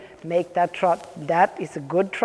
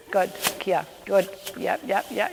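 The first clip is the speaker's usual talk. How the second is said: normal talking voice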